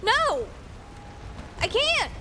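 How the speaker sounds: high-pitched, emphatic retold protest; exasperated disbelief. Telling the Canadian friend his accent went unnoticed, incredulous. Retelling own answer to friend.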